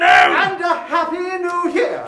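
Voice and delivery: loud, slow happy new year; cheerful shout back at the uncle; shouted greeting. Acted. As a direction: call it out defiantly.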